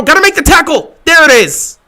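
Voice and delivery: high, loud cheering at the play; excited shout. Real, not acted. Fan watching the game, shouting excitedly. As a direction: yell at the screen as the tackle comes.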